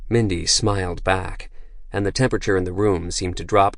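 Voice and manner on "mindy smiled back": plain reading voice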